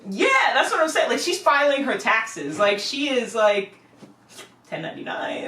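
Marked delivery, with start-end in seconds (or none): 4.7-5.4 breathy whisper dropped in as a joke; whispered aside. Acted. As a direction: drop your voice to a secret whisper. Aside about a baby's taxes.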